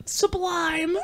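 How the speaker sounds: high mock yell, held back in volume; half-voiced, high-pitched movie exclamation; imitated yell. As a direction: quote the cry high without real volume. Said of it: "expecting him to yell something".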